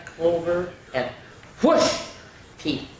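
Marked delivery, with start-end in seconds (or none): 1.6-2.1 high, loud whoosh; whoosh sound effect. Acted. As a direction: swoop the sound up.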